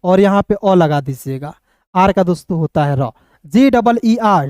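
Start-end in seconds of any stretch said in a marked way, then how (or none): none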